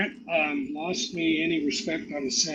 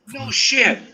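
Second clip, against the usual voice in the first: higher, louder stunned reply; astonished; 7 semitones higher, 7 dB louder than usual. Retold or imitated. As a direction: blurt it in disbelief. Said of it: "He goes".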